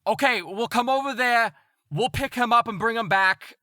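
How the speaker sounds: high, loud shouted reply; yelling out. Acted. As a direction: holler the plan back across the water. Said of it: "this woman that was yelling out".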